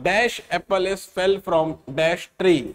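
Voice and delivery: teacher reads the exam question slowly; slow reading. Shown as a demonstration. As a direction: read it out very slowly, word by word. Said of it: "ए या N में कुछ चुनना है आपको".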